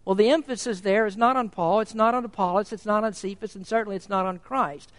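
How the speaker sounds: ordinary talk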